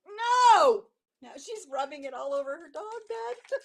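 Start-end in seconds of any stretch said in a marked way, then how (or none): 0.1-0.8 high loud yell; shouted no. Real, not acted. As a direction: shout it in real dismay. Dog rubbing scent on bed.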